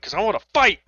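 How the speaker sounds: hammed-up combative retort; mock belligerence. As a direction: play-act spoiling for a fight. Acted belligerent answer, then laughs.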